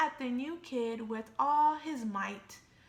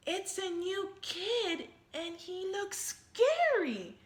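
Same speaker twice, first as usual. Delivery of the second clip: scared cry; 6 semitones higher, 4 dB louder than usual. Acted. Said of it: "cried Joey Mackerel".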